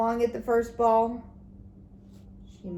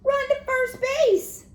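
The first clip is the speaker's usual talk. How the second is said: very high, louder, slow yell; excited yell; 6 dB louder, slower than usual. A narrator's reading. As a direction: shout the direction from the bench. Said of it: "yelled the boys".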